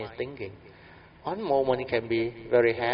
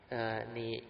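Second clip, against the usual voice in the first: ordinary talk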